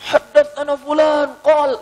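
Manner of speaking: high, loud, slow and drawn-out chant of the hadith chain; imitating the scholar reciting with renewed vigour; spirited recital. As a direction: declaim it with sudden fire, stretching each word. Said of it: "tiba-tiba dia semangat lagi".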